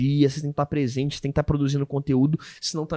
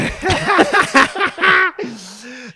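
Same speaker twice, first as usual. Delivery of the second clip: high, loud burst of laughter; 13 semitones higher, 9 dB louder than usual. Real, not acted. Host cracks up at guest's confession, loud laugh.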